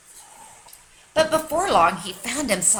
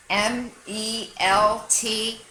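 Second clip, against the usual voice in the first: slow letter-by-letter E M M A; spelling out letters; much slower than usual. Shown as a demonstration. Dog spelling a new friend's name, slow letters. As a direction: space each letter out. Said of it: "He spelled everything".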